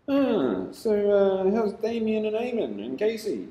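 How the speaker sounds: high, mock-chummy cop voice. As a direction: play the cop faking friendliness to win him over. Cop acting friendly, mocked.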